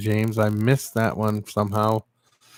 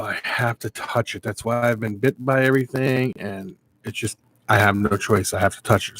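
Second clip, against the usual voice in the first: ordinary talk, nothing marked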